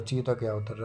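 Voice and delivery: usual speaking voice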